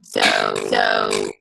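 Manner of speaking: glitchy robotic call audio; distorted robot voice on a bad call line. Real, not acted. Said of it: "You sound like a robot".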